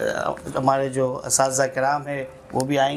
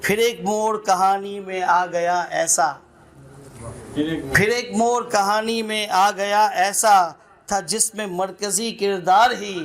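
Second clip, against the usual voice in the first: measured, lilting recital with repeated lines; poem recitation; 4 semitones higher than usual. Acted. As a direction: savour each line like a poet at a mushaira. Recites a nazm at a gathering. (नज़्म पढ़ने का अंदाज़)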